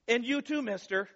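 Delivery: high, pointed mom voice, too rising; scolding mom. Retold or imitated. Mom turning round on the gloating sibling, sharp scolding. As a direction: catch the smug kid red-handed. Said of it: "the moment when mom turns around".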